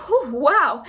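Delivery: loud, startled gasp of words. A narrator's reading. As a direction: gasp at the bad breath. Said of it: "he gasped".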